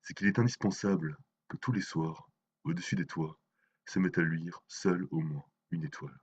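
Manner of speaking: solemn, measured poem reading; dramatic poem, phrase by phrase; poetry recitation. Acted. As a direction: recite the verse with weight, pausing between phrases. Recites translated poems by Mayakovsky.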